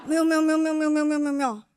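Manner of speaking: rapid-fire flustered denials; one long run of modest, panicky refusals; Zhang Yimou. Retold or imitated. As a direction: imitate a director hastily waving off praise. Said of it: "这个时候张艺谋啊".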